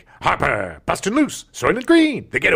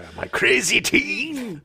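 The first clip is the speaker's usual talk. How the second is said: Heston voice; 10 semitones higher, slower than usual. Pretend. Playing Heston showing teeth.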